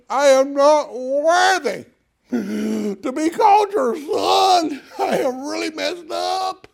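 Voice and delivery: high, loud, drawn-out hammed-up wail; wailed confession. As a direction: overplay a wretched son's rehearsed plea. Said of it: "he's preparing his whole speech".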